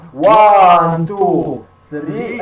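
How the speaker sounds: first count punched loud, then fading. Shown as a demonstration. Teacher counting the beats for the student. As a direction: count the beats aloud, hitting one. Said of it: "Try counting out loud".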